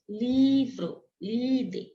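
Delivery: livro and líder, each with LI held long; stressed penultimate syllable. Shown as a demonstration. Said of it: "possuem a penúltima sílaba como sílaba mais forte".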